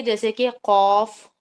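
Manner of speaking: قاف said slowly and full with rounded lips; teacher demonstrating a heavy letter. Shown as a demonstration. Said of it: "ہم اپنے ہونٹوں کو بھی گول کر لیتے ہیں".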